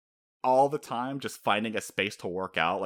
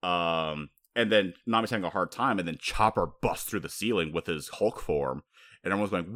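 ordinary talk

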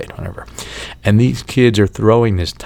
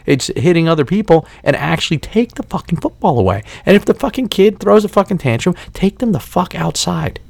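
higher pitched, worked-up rant; exasperated rant; 8 semitones higher than usual. Retold or imitated. Annoyed parent ranting at the mom about her kid, exasperated. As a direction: let the frustration spill over, pitch climbing. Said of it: "I'm like".

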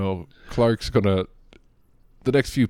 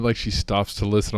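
ordinary delivery

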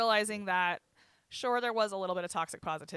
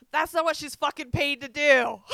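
much higher and louder, indignant yell; outraged shout; 8 semitones higher, 12 dB louder than usual. Retold or imitated. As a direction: shout it for her, appalled. Retells Caitlin's outraged reaction.

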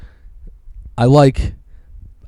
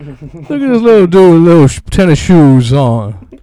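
teasing; 7 semitones higher, 8 dB louder, slower than usual. Acted. Teasing friend about tennis.

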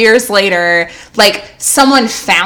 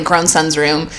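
ordinary delivery

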